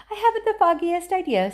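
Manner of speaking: high, loud airy showman voice; breezy Wonka. A narrator's reading. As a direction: toss it off lightly as the eccentric host.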